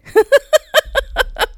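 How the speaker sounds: high, bubbly real laugh. Real, not acted. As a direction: burst out laughing at your own remark. Laughs at own line.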